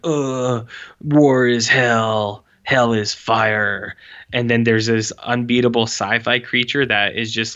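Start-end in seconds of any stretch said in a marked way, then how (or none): 0.0-3.9 mock grim soldier. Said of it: "they're like".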